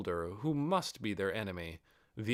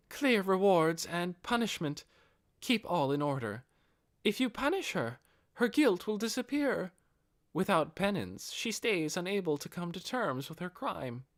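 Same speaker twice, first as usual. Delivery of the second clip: higher, earnest advisor voice; a loyal advisor pressing her point; firm counsel; 9 semitones higher than usual. Acted. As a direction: lay out the principle firmly. Albedo argues for punishment.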